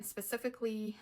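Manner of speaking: ordinary talk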